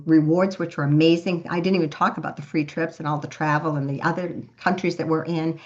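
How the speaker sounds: ordinary talk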